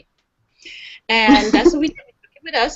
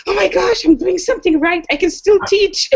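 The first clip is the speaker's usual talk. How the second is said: proud delight; 8 semitones higher than usual. Retold or imitated. Mother proud, holding her heart.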